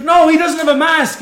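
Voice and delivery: high, loud scolding retort; imitated yelling. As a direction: mimic a server shouting down an excuse. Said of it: "she yelling at a fucking dog".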